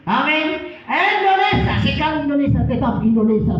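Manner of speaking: loud, high patriotic cry; rally the room with it; rousing shout. Real, not acted. Preacher rouses the congregation for Indonesia, shouting. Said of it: "preacher rallies crowd".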